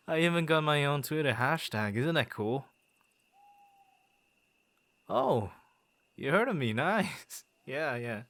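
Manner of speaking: giddy, delighted bragging; excited boasting. Acted. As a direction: show off your fame with glee. Boasting about his hashtag.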